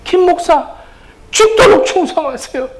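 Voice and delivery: mother's exhortation. Retold or imitated. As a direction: urge him like an old mother. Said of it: "우리 어머니는 돌아가실 때까지".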